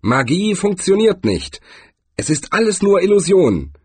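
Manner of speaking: high, loud cry of triumph; building triumphant declaration; triumphant shout. A narrator's reading. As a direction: proclaim it to the crowd, high and triumphant. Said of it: "rief ein Sternenmann triumphierend".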